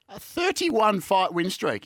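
slow, disbelieving echo of the number; stunned, slow repeat of the win streak; incredulous repeat. Real, not acted.

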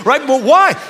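ordinary delivery

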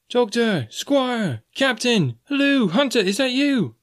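high, ringing call from a distance. Acted. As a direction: hail them across the stockade. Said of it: "came the cries".

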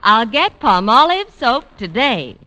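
ordinary delivery

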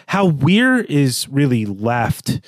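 ordinary talk, nothing marked

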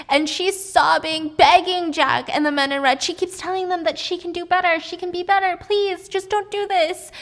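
high, agitated retelling of pleas; frantic begging. Retold or imitated. Narrator relaying Alice begging men in red, frantic.

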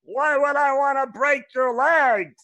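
drawn-out, loud Brad Garrett bit; Brad Garrett impression. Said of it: "that was Brad Garrett".

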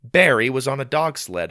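usual speaking voice

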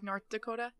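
"North Dakota" with the th dropped, said softly. Shown as a demonstration. Friend showing how she says "North Dakota", softly. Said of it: "I pronounce it like North Dakota".

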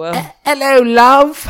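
high, loud caricature British greeting; mock British accent. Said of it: "stopped doing that accent".

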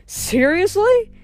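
very high, drawn-out incredulous question; laughingly incredulous; amused disbelief. A narrator's reading. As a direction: stretch it out and swing sharply upward. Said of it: "Leo laughed".

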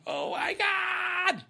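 high, stretched shriek of shock; shocked cry. Real, not acted. Host reacting to Sheila's smile, stretched shocked cry. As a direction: squeal out the shock.

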